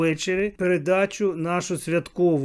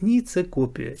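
ordinary delivery

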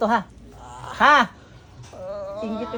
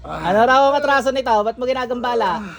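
raised-pitch, forceful questioning; stern interrogation; 4 semitones higher than usual. Real, not acted. Healer demanding why the spirit troubles the patient, sternly. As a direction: press hard for an answer.

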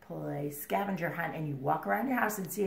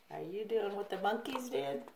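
ordinary delivery, nothing marked

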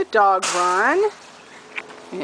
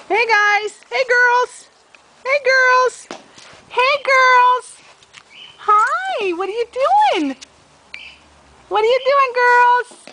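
much higher voice for the dogs; puppy talk; 12 semitones higher, 3 dB louder, slower than usual. Real, not acted. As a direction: coo at the puppies like babies. Cooing to puppies in dog run.

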